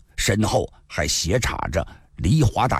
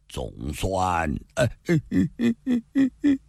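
breaking into sobs; 2 semitones lower than usual. A narrator's reading. Spearman remembers his slain elder brother, breaking into sobs. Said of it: "他突然哭了".

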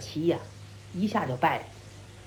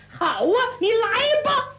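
defiant challenge; 8 semitones higher than usual. A narrator's reading. Brawler dares the other man to fight, defiant. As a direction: take up the fight eagerly, pitched high.

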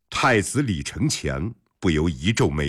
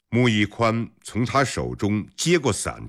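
plain reading voice

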